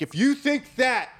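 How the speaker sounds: high-pitched imitation of a guy spoiling for a fight; puffed-up challenge, imitating the angry friend; mock confrontational. Friend demanding they step outside, mock confrontational. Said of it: "he's going off".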